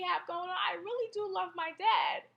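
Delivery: fighting back real tears while talking; choked up. Real, not acted. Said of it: "I'm getting choked up now".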